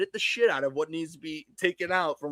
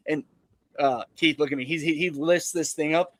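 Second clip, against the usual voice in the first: ordinary delivery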